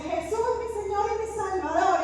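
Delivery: high, lifted cry without full volume; mock shout, pitched well up; retold shout. Dreamer floating free in the dream, high retold shout. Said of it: "lo grité tan duro en el sueño".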